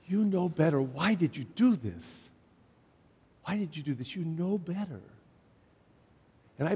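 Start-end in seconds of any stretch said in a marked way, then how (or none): 0.1-5.0 quiet disappointed parent. Retold or imitated. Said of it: "They look at their kid and say".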